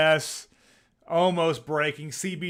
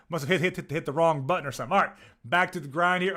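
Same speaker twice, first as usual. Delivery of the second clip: usual speaking voice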